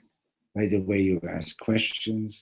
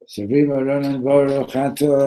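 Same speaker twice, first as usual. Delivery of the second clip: loud, raised declamation of a blessing; intoned blessing; 6 semitones higher, 11 dB louder than usual. Retold or imitated. Makel tries Kiddush a third time, loudly intoning. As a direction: forge ahead with the blessing at full voice.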